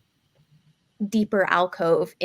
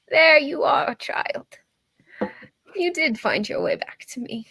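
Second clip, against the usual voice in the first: put-on higher, whispery old woman; old lady voice; 8 semitones higher than usual. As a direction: croon it like a kind old crone. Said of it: "I was just getting into my old lady voice".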